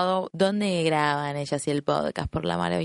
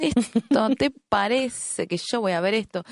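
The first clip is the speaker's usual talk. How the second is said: sceptical; 6 semitones higher, slower than usual. Retold or imitated. Retold sceptical self.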